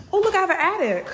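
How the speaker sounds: high, loud burst of real excitement; excited discovery. Real, not acted. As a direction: blurt it out, thrilled at the find. Discovers an attic in new house.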